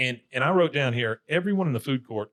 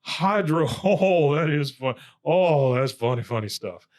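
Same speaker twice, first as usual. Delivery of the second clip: higher, loud mock guffawing, settling at the end; imitated laughing; 4 semitones higher, 4 dB louder than usual. Hank cracks up at Bobby's skit, imitated laughter. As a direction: act tickled, laughing through the words, then ease off. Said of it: "Hank is just laughing his ass off".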